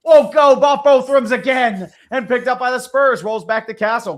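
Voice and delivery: high, loud call of a live shot; excited play-by-play. Real, not acted. Calling a missed three for viewers, excited play-by-play. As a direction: call the miss with rising energy, riding high.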